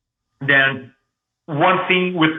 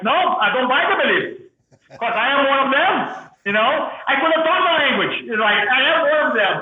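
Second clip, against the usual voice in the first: emphatic retort; 9 semitones higher than usual. Retold or imitated. Retorting to the skeptic.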